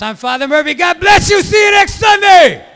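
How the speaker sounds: high, loud arena-rock priest farewell; rock star priest. Pretend. Priest closing mass like a rock star, loud and high. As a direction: shout it out like closing a rock show. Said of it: "Nah, I'm lying".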